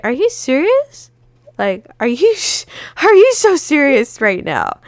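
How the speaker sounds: high, incredulous "are you serious"; fed-up friend finally snapping; exasperated disbelief. Retold or imitated. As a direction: let your irritation climb. Friend arrives hours late.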